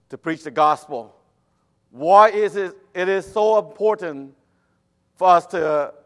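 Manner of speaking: loud, high preaching. Acted. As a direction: preach it with full force. Sermon at full force.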